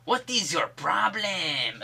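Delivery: slow, high put-on Mexican accent; mock Mexican accent. Headbutted defender confronting his attacker, mock Mexican accent. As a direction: drag it out in a hammed-up Mexican accent. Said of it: "Except he's not Mexican".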